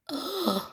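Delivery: a quick startled intake of breath; sharp high gasp. Retold or imitated. Recalling the whisper in her ear, sharp gasp. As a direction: gasp as if hearing it again.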